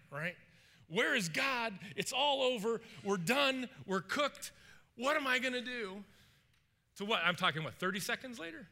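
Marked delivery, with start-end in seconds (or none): 0.9-6.0 high acted panic in short bursts; freaking out. Servant surrounded by an army, freaking out. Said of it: "You're freaking out".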